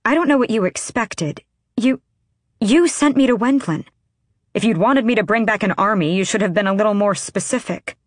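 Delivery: indignant. A narrator's reading. Heated argument, indignant reply.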